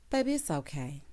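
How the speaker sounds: usual speaking voice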